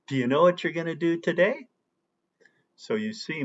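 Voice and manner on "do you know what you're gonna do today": quick, run-together "Do you know what you're gonna do today"; fast connected speech. Shown as a demonstration. Teacher repeats the fast sentence for students. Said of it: "the other sentence".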